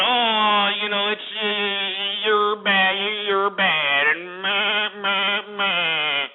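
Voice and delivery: drawn-out mocking bleat; mocking whiners. Host imitating listeners' facade of disapproval, mocking whine. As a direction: whine it back at them in mockery. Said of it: "play this facade".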